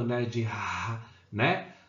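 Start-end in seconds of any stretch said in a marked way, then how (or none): 0.4-1.0 "R" as a breathy dragged H sound. Shown as a demonstration. Teacher showing how H sounds in house, "R" breathed out and dragged. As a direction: make "R" a rough exhaled h, like English H. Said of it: "desse R arrastado".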